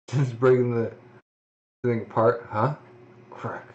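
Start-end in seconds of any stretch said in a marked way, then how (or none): none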